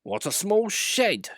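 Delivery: high, slow grumble; a proud engine grumbling at his new shed. A narrator's reading. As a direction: sniff at the shed in disdain. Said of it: "grumbled Sir Handel".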